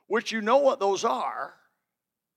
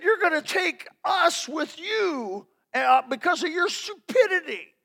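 mock angry scolding; 7 semitones higher than usual. Passenger blaming the pilot, high-pitched indignation. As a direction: stretch the words out in mock outrage, pitched high.

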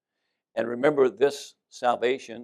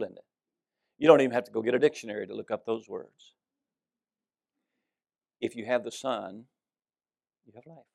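ordinary delivery

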